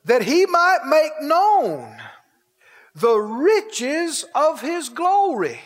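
slow, drawn-out scripture reading; slow rereading. Real, not acted. Preacher rereading a verse for the congregation, real slow. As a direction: read it real slow, stretching key words. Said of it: "read that again real slow".

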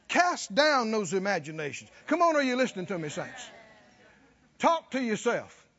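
normal talking voice

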